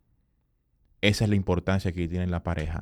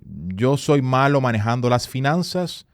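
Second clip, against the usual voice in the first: self-limiting belief quote; 5 semitones higher, 5 dB louder, slower than usual. Retold or imitated. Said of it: "yo tenía la concepción de".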